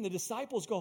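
usual speaking voice

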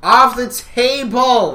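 high, loud, drawn-out holler; mock outrage shout. Shouting across to the other hosts, mock outburst. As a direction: bellow it slowly.

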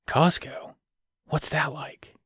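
soft breathy whisper. A narrator's reading. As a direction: hush it, barely voiced. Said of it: "he whispered".

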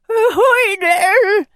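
a frozen pirate forcing out a greeting; cold pirate, calling out through chattering teeth. Acted. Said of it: "through chattering teeth".

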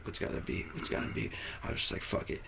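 very soft, near-voiceless self-talk; whispered thinking. Retold or imitated. Guy deciding what song to put on, whispering to himself. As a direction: whisper it as a private thought.

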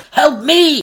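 high, loud plea; pleading. Acted. William begs the artist, high loud plea. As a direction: beg right back at her. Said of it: "he said".

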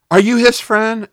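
loud, high accusing question; sharp demand. Retold or imitated. Ambulance worker confronting the sick man's friend, loud and accusing.

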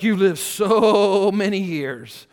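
loud, slow mocking drawl; sarcastic God. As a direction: stretch it out with mock awe. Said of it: "getting a little sarcastic".

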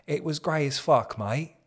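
put-on London accent. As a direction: play a London bloke, mate. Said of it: "that guy does sound like he's from Lo".

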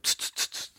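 soft hissed brushing sounds; scrubbing hiss. Retold or imitated. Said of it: "I was like".

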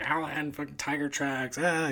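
mock alarm at the paw print; retold panic. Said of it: "And I was like".

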